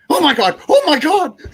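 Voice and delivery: the doctor gasping at the wound; imitating the doctor's shock, high and loud.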